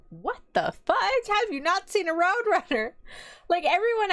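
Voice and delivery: retold high-pitched disbelief; shocked. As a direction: act out being stunned, pitch shooting up. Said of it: "I was shocked".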